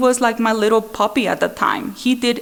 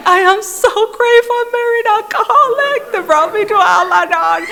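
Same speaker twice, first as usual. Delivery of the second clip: much higher, loud, laughing gush; newly converted member gushing loudly about being grateful; gushing gratitude; 12 semitones higher, 4 dB louder than usual. Retold or imitated. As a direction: overflow with giddy gratitude, laughing. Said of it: "one of those annoying people".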